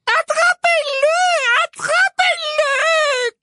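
extremely high, drawn-out shriek; screamed. A narrator's reading. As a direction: shriek the order frantically. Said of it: "cria Ombrage".

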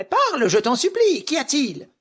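high, loud anxious woman's voice; eager pleading wife. Acted. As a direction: beg him to speak, urgently. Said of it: "Sa femme l'interrogea avidement".

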